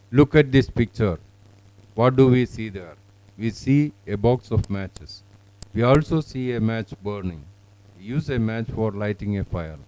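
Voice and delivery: measured practice reading with pauses between sentences; model student reading. Shown as a demonstration. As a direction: clear read-aloud, each sentence separate with falling end. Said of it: "let us record this one".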